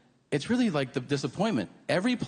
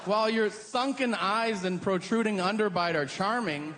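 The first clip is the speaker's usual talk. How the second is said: mock polite; 7 semitones higher than usual. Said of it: "interrupt and be like".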